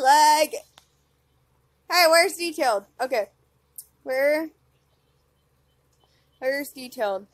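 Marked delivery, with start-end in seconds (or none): none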